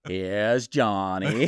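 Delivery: slow, stretched, laughing Here's Johnny; Jack Torrance. Retold or imitated. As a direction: menace it out slowly through a laugh. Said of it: "that Jack Torrance character going, like, with that Maine accent".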